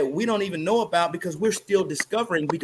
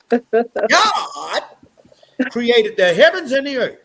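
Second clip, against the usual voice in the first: preacher voice; 5 dB louder, slower than usual. Pretend. Preacher-style biblical quote.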